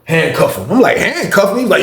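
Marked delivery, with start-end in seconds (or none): 0.0-0.7 slow, even command in his usual pitch; unbothered lieutenant's instruction; matter-of-fact order. Retold or imitated. 1.0-1.6 high-pitched, startled question; taken aback, voice jumping up; incredulous echo. Retold or imitated. His startled echo of the order.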